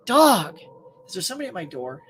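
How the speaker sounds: ordinary delivery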